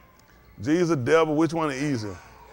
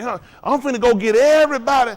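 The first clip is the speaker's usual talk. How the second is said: louder, higher eager boast; mock zealous preacher; 4 semitones higher, 6 dB louder than usual. Mocking preachers who chase everyone, louder and eager. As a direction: puff up like a know-it-all recruiter.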